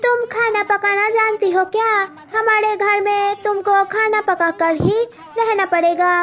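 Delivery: high, demanding in-law voice; groom's mother testing Malti's cooking; haughty groom's mother. Acted. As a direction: quiz the bride condescendingly.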